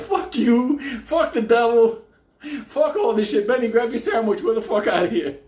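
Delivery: loud, high angry dismissal; acted defiant outburst. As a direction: shout them off and storm out, re-enacted. Boys storming out on the priest.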